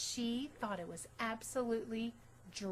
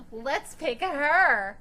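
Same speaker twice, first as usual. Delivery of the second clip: very loud, slow jeering; mocking tease; 5 semitones higher, 14 dB louder, much slower than usual. Rita jeering at her in the playground, loud slow taunt. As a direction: jeer across the playground. Classmate teasing.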